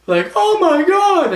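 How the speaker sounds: very high, loud mock shriek; shocked crowd. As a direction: imitate a stunned crowd screaming. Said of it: "the crowd would be like".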